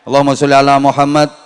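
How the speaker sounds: fast, loud call of the selawat; called-out blessing. Real, not acted. Preacher calls out the blessing to the crowd, loud and fast.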